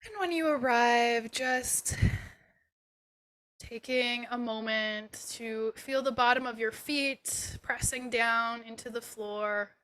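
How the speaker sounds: unhurried and settling, guide them gently inward; guided meditation. Acted. Yoga teacher leading students through a body scan, guided meditation.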